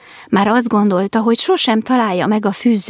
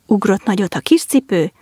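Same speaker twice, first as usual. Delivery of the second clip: ordinary delivery, nothing marked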